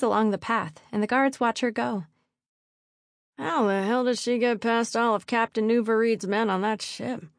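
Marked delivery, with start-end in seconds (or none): 3.4-7.3 guard's voice, not hushed; lightly voiced guard wondering aloud; murmuring guard. A narrator's reading. One guard to the other as she leaves, mildly amazed. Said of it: "one murmurs to the other".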